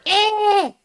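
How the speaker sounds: very high loud creature screech. Retold or imitated. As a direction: screech like the thing above the machine. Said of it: "this creature ... was going".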